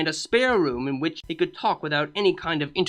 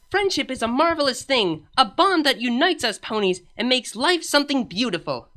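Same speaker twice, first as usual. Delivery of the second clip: a slightly higher, dreamy voice; lightly voiced young mare; 8 semitones higher than usual. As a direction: speak of friendship like it's wonderful. Character voice for Twilight.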